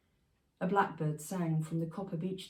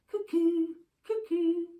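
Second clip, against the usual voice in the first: high bird-like cuckoo call; 11 semitones higher, 6 dB louder, slower than usual. Acted. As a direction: sing it out like a cuckoo. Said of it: "I heard the cuckoo".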